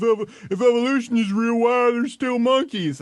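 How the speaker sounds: mock a silly argument, loud and high; silly arguer. Host mimicking Christians' silly arguments, high goofy mock voice. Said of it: "make silly arguments".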